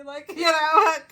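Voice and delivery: loud burst of laughter; laughing. Real, not acted. Fan cracking up remembering the singer. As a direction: laugh out loud, can't stop. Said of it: "laughing so hard".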